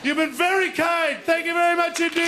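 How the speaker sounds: high, stretched-out thanks to the crowd; rousing sign-off. Real, not acted. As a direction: call your thanks out over applause. Signing off to the audience.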